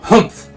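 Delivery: a loud grumpy bear huff; loud humph. Acted. Bear huffing at all the books, loud humph. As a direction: snort it out loud and cross. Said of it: "Bear's voice was a little loud".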